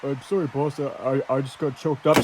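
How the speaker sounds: very low, soft and cowed; meek apology. Acted. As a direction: apologize timidly to the boss. Commentator apologizes meekly to boss.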